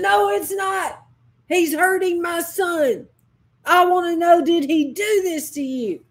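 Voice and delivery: loud, high, outraged insistence; a distraught mother demanding the truth; anguished anger. Retold or imitated. Mother presses her injured son for an answer, anguished anger.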